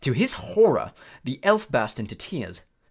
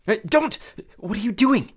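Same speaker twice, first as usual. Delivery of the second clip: ordinary delivery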